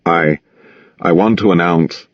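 ordinary delivery, nothing marked

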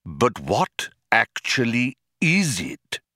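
patient, pointed questioning; suffering fools gladly. A narrator's reading. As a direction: ask as if indulging fools. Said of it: "air of one who suffers fools gladly".

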